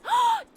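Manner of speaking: very high, loud sharp gasp; stunned intake of breath; huge shocked gasp. Real, not acted. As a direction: gasp as if struck. Shock at his leaving.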